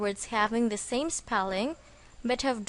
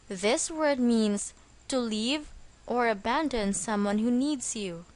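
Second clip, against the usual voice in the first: normal talking voice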